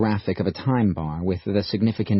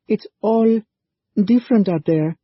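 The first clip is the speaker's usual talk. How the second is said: put-on high, breathless, rattled girl's voice; gasping for breath; 13 semitones higher, 6 dB louder than usual. A narrator's reading. Sal back from running through the streets, breathless and scared. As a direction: gasp it out after a hard run. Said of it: "she said, gasping for breath".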